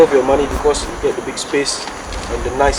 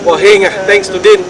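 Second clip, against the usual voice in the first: excited; 6 semitones higher, 8 dB louder than usual. Real, not acted. Happy intro, lucky.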